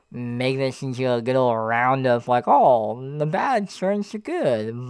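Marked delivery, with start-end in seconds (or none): 2.5-4.9 high, slow sing-song cliché; mock cheesy. Mocking a cliché.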